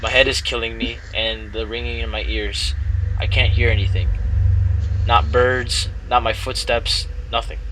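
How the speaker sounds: steady first-person story reading; story narration. Acted. Streamer reading the game story aloud to girlfriend, narrating. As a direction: read the game text as a tale.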